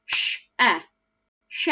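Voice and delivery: she sounded out with eh, as "sh, eh"; wrong reading. Shown as a demonstration. Teacher showing the wrong sound, "sh, eh" for she. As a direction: read "she" wrongly with a short eh. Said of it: "if I read it with that eh, it'd sound like this".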